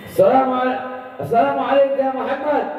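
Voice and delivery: high eager cry of greeting; eager traveller's greeting. Acted. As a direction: burst in calling his name.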